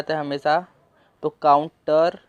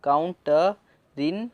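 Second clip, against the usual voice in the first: slowly drawn काउंटरिन; slow syllables; much slower than usual. Shown as a demonstration. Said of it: "तो countering". (काउंटरिन को खींचकर)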